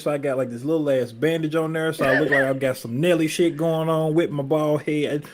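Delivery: ordinary talk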